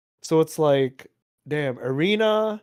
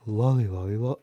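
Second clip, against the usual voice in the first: sultry voice; 8 semitones lower, faster than usual. Pretend. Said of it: "do your most sultry voice".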